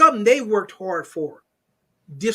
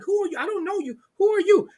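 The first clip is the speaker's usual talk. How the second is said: 1 incredulous retort; 10 semitones higher, faster than usual. Retold or imitated. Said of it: "I'm like".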